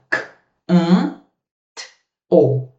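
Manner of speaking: sounding out "K, an, t, o" one sound at a time; phonemes split apart. Shown as a demonstration. Teacher breaking canto into phonemes for the class. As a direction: spell the sounds out slowly. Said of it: "Tá vendo, ó?".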